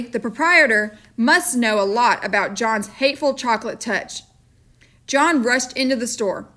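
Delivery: plain reading voice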